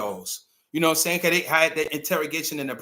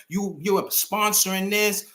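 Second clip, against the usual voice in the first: normal talking voice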